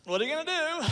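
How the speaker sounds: put-on high boyish question; lightly voiced kid asking; son's voice. Twelve-year-old son asking dad, high and boyish. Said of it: "He goes".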